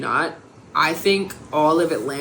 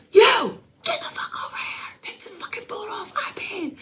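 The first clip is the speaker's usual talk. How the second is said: very high strained yell-whisper imitation; mock shrieking girl. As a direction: shriek it high and breathy at the boot man. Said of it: "the girl who was shrieking".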